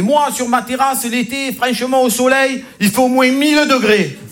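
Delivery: high, emphatic bragging colleague. Retold or imitated. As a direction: boast it like it's gospel. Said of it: "il m'a dit". (collègue qui exagère, voix haute et appuyée)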